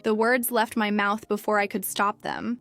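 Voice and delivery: ordinary delivery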